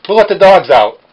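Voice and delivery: high bellowed call at full volume; loud shout. Acted. Shouting a random chant between jokes, bellowing. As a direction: belt it out like a party chant.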